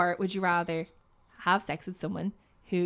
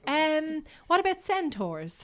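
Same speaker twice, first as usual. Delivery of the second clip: quoted listener asking; 7 semitones higher, slower than usual. Retold or imitated. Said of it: "someone recently who was like".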